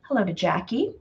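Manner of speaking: normal talking voice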